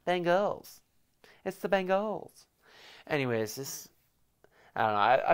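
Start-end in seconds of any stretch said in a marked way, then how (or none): 0.1-2.5 put-on high, silly accent with "Bengals" as bang-goals; "Bengals" in a high made-up accent, rounded "oh" ending. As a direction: put on a daft accent, pitched up. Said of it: "Is that like an accent?".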